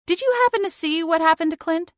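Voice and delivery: breezy, faked calm, fast and light; overly casual question hiding annoyance; exaggerated casual calm. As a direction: pretend you don't care at all. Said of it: "with exaggerated calmness and a casual tone".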